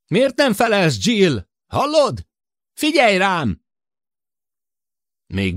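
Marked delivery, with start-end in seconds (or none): 0.1-3.6 high, loud impatient shout over the radio. A narrator's reading. Sid shouting impatiently at Jill through the radio. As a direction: yell at her to answer, losing patience. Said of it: "türelmetlenül kiabálni kezdett".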